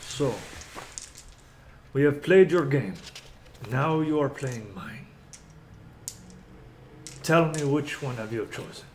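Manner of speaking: measured, deliberate villain with long pauses; lightly voiced menacing overlord; Strahd. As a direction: deliver a formal challenge, unhurried. Game master plays Strahd.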